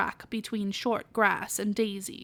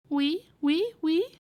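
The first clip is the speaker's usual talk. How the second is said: piglet answer; 6 semitones higher, slower than usual. Acted. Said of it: "listening with his head on one side".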